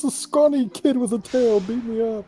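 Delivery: high-pitched mock whine; acted-out tattle, pitched well up; mock complaint. Retelling a character's excuse about getting beaten up, mock complaint. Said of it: "He goes".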